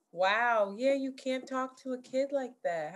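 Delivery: ordinary delivery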